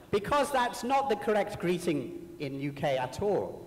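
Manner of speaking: ordinary delivery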